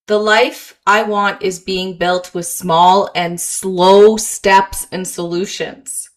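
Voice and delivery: slow affirmation. Shown as a demonstration. Coach modelling self-talk for listeners, slowly. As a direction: spell out the affirmation step by step. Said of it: "you to remind yourself".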